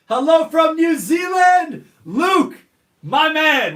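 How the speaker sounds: very high, loud, slow greeting; real excited yell of welcome; excited shout. Real, not acted. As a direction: hail a friend across the room. Greeting a viewer he knows.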